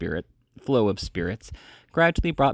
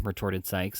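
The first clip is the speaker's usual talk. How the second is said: plain reading voice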